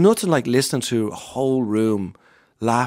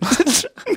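high, breathy burst of laughter. Real, not acted. Comedian laughing while telling a moving fan story.

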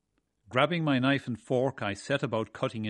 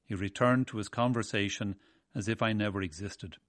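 usual speaking voice

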